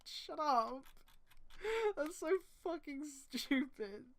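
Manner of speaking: ordinary talk, nothing marked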